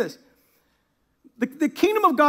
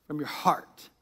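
low, near-whispered "heart"; hushed correction; 8 semitones lower, 10 dB quieter, slower than usual. A narrator's reading. Preacher correcting "head" for the congregation, hushed. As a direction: drop to a soft, grave near-whisper.

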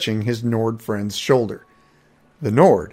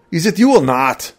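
higher, louder, spat at the strangers; 6 semitones higher, 6 dB louder than usual. A narrator's reading. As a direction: bite the question off impatiently. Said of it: "the Nord spat".

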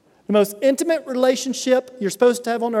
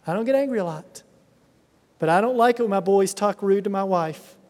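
ordinary talk, nothing marked

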